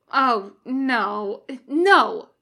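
loud, rising no, no; emphatic refusal. Acted. Demon cuts off the summoners with a loud refusal. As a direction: shut the idea down hard.

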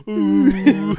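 high laughing squeal; beluga whale squeal. Real, not acted. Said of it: "you sounded like a beluga whale".

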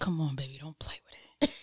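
whispered mock coaxing; playful whisper. Host joins co-host's "don't play with it" joke, playful whisper. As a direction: whisper it teasingly to your friend. Joining in the hushed teasing.

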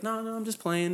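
high, soft mock reassurance; prankster backpedalling. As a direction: lightly imitate a girl calling off her prank. Voicing prankster girl backpedalling.

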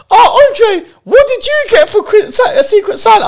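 high, loud mocking sing-song; teasing mock voice. Coworker teasing Andre about his gift, high mocking voice. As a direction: needle him in a high taunting voice. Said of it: "Alex is like".

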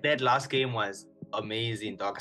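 normal talking voice

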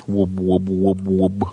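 low monotone run of whoops; low-pitched repetitive whoop noise; imitated whooping. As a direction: drone out whoops in a steady rhythm. Whooping noise after bird joke.

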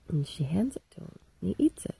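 whispered narration; breathy hush as Eve hands over the fruit. Acted. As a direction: lean in and whisper it like a secret. Hushed storytelling of the fruit.

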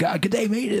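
high, amped-up Aussie greeting; dialled-up Australian accent. Acted. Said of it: "they dial up the".

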